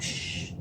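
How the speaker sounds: unvoiced-style soft "shh" imitation; quick quiet shush, acted out; retold hushing. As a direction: imitate someone shushing the room. Boyfriend hushing friends during call.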